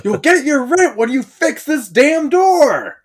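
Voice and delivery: high, loud, slow angry yell; gruff impatient scolding. Retold or imitated. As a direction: yell back at the landlord. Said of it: "the Rent guy".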